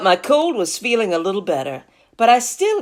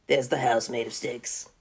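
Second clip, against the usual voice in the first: usual speaking voice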